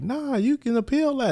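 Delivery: squeaky high-pitched Lamar, sure of himself; high mock crackhead voice. Old crackhead to David at sentencing, high squeaky voice. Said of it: "He's like".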